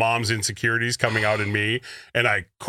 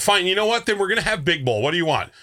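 exasperated; 9 semitones higher than usual. Retold or imitated. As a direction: snap back in frustration. Acting out the dinner argument.

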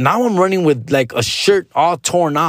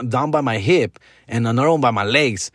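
ordinary talk, nothing marked

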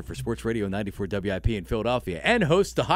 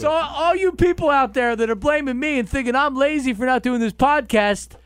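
mocking whiny voice; 13 semitones higher, 5 dB louder than usual. Mocking complaint, high far voice.

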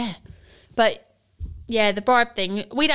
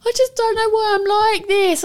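put-on upset child; 10 semitones higher, 4 dB louder than usual. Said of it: "crying his eyes out".

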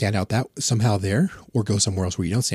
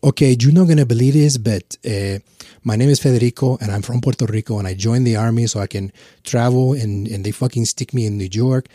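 fake Puerto Rican accent; 3 semitones higher, 7 dB louder than usual. Soldier pitching himself to a Montreal woman in a put-on Puerto Rican accent. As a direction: lay the accent on thick, all charm. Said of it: "I'd bust out".

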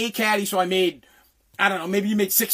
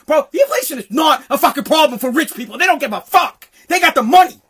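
loud, raised, exasperated outburst; angry rant; 4 semitones higher, 7 dB louder than usual. Real, not acted. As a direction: build to an indignant shout. Heated rant about inflation.

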